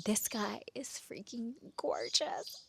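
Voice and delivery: slow awed whisper; breathless slow whisper of awe; swooning whisper. Retold or imitated. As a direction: whisper it like you can't believe your eyes. Swooning over his photo.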